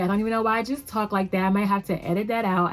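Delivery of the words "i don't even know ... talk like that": normal talking voice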